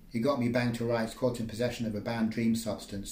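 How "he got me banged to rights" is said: ordinary delivery, nothing marked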